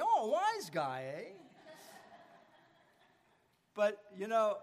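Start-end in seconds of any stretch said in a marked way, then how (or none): 0.0-1.3 slow, louder Curly from the Three Stooges, wisecracking; mock Curly jab at a friend; Curly impression. Pastor mimics Curly teasing his friend Josh, slow and loud. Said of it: "do my Curly on the Three Stooges routine".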